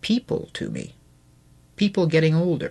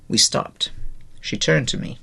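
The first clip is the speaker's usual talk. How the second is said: ordinary delivery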